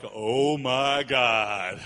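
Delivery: long, low drawn-out groan of dread; hammed-up slow dismay; mock dread. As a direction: say it very slowly and low, as if doom just walked in. Retold office dread at his arrival.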